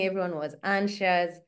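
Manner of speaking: ordinary delivery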